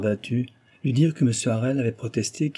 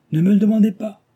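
much higher, loud and proud; proud outburst; 10 semitones higher, 7 dB louder than usual. A narrator's reading. Husband proudly refuses Cécile's question, high and loud. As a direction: refuse to answer, head held high. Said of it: "repartit-il fièrement".

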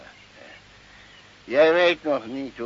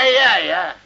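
spottend; 5 semitones higher, 7 dB louder than usual. Acted. Ironic mock proclamation, laugh.

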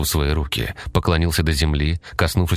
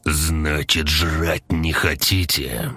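gruff menacing monster; slower than usual. Acted.

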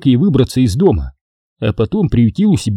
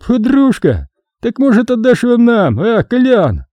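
put-on leering drunk voice; 11 semitones higher than usual. A narrator's reading. Drunk guest leering at girl.

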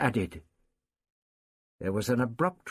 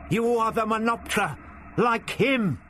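urgent shout; 11 semitones higher than usual. A narrator's reading. Ian insists to the captors.

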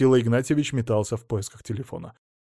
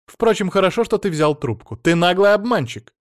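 raised, indignant voice; angry accusation; 6 semitones higher, 5 dB louder than usual. A narrator's reading. Mother-in-law accuses son-in-law of lying, angry scolding. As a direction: scold him down the phone, higher and louder.

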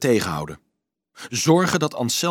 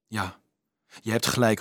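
ordinary delivery

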